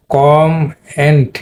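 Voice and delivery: "কম এন্ড" split in two with a pause; "কম এন্ড" as two syllables, stress on "কম"; syllable breakdown. Shown as a demonstration. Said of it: "stress প্রথম সিলেবলে দিয়েছে".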